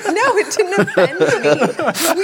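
amused, laughing protest; laughing denial. Real, not acted. Laughing denial after being compared.

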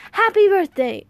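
high, loud birthday cheer; booming old man's happy greeting; exuberant exclaim. Acted. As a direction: burst out with joy at the door. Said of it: "the man at the doorway exclaimed".

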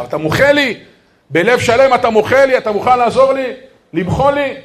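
loud, high, insistent begging; urgent pleading. Retold or imitated. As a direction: imitate someone begging forgiveness, question after question. Acted insistent plea for forgiveness.

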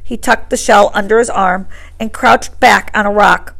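ordinary delivery

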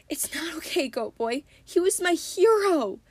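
put-on a big kid's heartbroken cry; tearful Tyson. Tyson to Grover, crying over his lost hero, tearful. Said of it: "Tyson sobbed".

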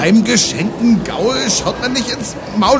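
high, loud pompous captain; Captain Dirk voice. Acted. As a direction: play the bold space captain.

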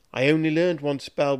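frightened apprentice pleading, lightly whimpered; whimpering. Acted. Apprentice facing his master's dagger, mild whimper. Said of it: "whimpered Polly".

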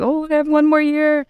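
high, wailing lament; hammed-up sorrowful cry; mock lament. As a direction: pitch it up like a mock wail. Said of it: "and I was like".

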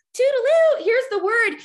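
high, loud sing-out; mock breezy greeting. Mocking a breezy Bible reader.

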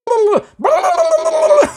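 very high shouted tongues babble; hammed-up ecstatic babbling; imitating the preacher shouting in tongues; mock speaking in tongues. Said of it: "praying in the tongues".

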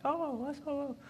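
high, absent-minded oh's from a man half-listening; distracted husband. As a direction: answer sweetly while watching the game. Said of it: "I'm like".

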